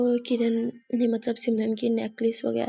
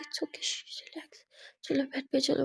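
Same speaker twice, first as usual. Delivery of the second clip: hushing whisper; 3 semitones higher, 6 dB quieter than usual. Acted. Kiran hushing Simran.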